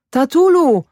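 high, drawn cry to a father; boy calling out. A narrator's reading. Boy runs up calling his father, urgent high call. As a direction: call out high across the yard. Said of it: "wołał chłopiec".

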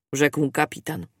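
ordinary delivery

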